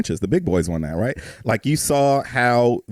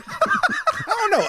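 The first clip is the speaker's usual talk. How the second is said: laughing; 3 dB quieter, much slower than usual. Real, not acted. Laughing at feet debate.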